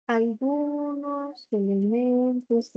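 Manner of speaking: dragged out very slowly, bored; bored slow speech. Shown as a demonstration. Teacher showing students how bored, too-slow speech sounds, dragged out. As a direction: stretch it like you don't want to be there. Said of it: "parece que lo estás diciendo como aburrido".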